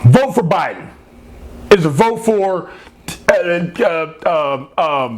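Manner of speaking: mock a fumbling campaign pitch; Biden impersonation. Host mocking a politician losing his thread, halting mild impression. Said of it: "are you doing the Biden impersonation".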